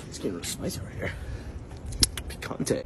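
hushed teasing whisper. Acted. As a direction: whisper it like a secret. Teasing aside about girlfriend.

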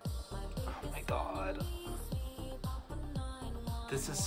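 breathless whispered oh my god; awed whisper. Real, not acted. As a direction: let it out as a hushed gasp. Awestruck by the look.

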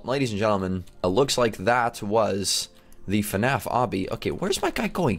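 ordinary delivery

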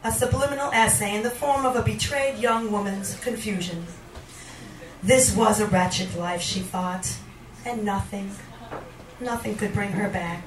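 poetry reading. Acted. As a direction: sink gradually into a quiet, resigned refrain. Reads a poem aloud.

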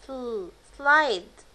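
"سل" then slide drawn out very slowly; very slow sl for learners; slow sl blend. Shown as a demonstration. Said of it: "اس ال".

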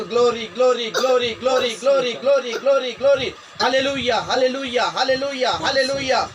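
loud, high, repeated praise chant; full-force revival praise; fervent praise shouting. Real, not acted. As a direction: pound out each word like a battle cry.

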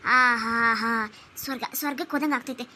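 groaning with relief as her feet are rubbed; blissful sighs. Acted. Mother-in-law sighing with relief during the foot massage.

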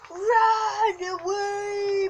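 cartoon cry. Fleeing in game.

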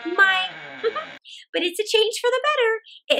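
put-on high, chuckling old woman voice; laughing old woman. Old woman finds a stone, laughing happily. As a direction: laugh at her luck.

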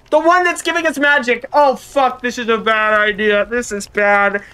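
high, loud frantic dismay; panicked realization. Real, not acted. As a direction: freak out at your own mistake. Panicked realization about the relics.

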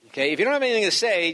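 ordinary talk, nothing marked

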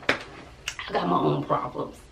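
soft, slow whispered aside. Real, not acted. Mother brushing off critics in a whispered aside. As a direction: drop it under your breath.

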